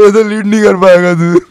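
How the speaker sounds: high, loud, stretched-out scolding; exasperated yell, played for laughs; mock angry outburst. Storyteller re-enacting himself scolding the crying boy, mock angry outburst. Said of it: "मेरे को गुस्सा आई".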